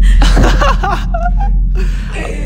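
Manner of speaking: high, breathy burst of laughter. Real, not acted. Host laughing after the stripper offers a private dance. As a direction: crack up at the answer.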